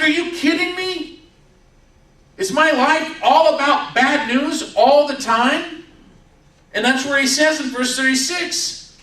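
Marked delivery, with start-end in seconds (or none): 0.0-5.8 high, incredulous outburst as a fed-up father; acted losing it. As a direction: play a father losing it, pitched way up. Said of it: "this is where Jacob just loses it".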